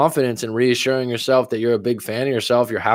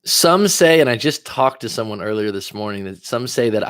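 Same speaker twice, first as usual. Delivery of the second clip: ordinary delivery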